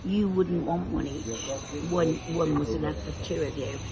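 normal talking voice

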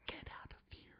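hushed, voiceless whisper; urgent mock whisper. Man urges his companion to slip away, whispered. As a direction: whisper it so the cops can't hear.

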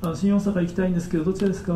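well-formed sentence said softly; small voice, properly pronounced. Shown as a demonstration. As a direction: ask politely in a small, clear voice. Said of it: "小さい声で喋れたらわかるのに".

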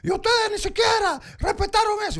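retold outburst at the boss, high and shouting; angry outburst. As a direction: tell off the boss, voice cracking high.

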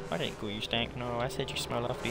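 low, soft backtracking; hushed placating. Pretend. Player placating a hunting alien, hushed placating. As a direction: appease it quietly, voice dropped.

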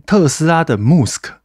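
"Musk" with the radio host's vowel; imitated pronunciation of Musk. Quoting another show's host on Tesla, imitated pronunciation of "Musk". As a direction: stretch "Musk" as the other host says it. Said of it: "我听到他在念特斯拉的Musk".

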